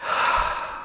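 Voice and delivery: long audible sigh out; centering out-breath; demonstrated exhale. Shown as a demonstration. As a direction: let the breath go in a soft sigh. Models breathing out in exercise.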